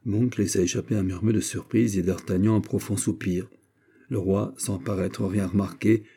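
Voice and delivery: ordinary delivery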